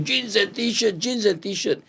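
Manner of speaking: high, sing-song market cry; imitating other sellers shouting their wares; hawker's call. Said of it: "Everybody was screaming".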